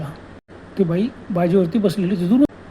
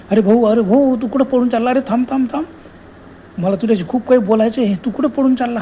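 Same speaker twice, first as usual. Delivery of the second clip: higher, louder shouts of thamb; ghost calling after him; 4 semitones higher, 4 dB louder than usual. Acted. Ghost woman yelling after fleeing Prabhakar, loud and high. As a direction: chase him with your voice. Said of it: "अरे म्हणतं".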